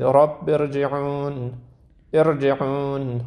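ارجعون slowly, with a thick, heavy ر after the alif; drawn-out ارجعون, ر full and heavy. Shown as a demonstration. Quran teacher showing students the heavy R in ارجعون. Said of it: "را رو باید تفخیم کنید".

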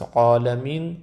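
عالمین with a dark, heavy عا; wrongly heavy AYN. Shown as a demonstration. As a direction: thicken the عا of عالمین as if it were an elevated letter. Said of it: "استعلا داره در حالی که اینطور نیست". («عالمین» با عینِ درشت، به اشتباه)

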